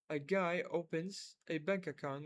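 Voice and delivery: unhurried, word by word; slow model reading. Shown as a demonstration. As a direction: read it slowly and clearly. (قراءة متأنية)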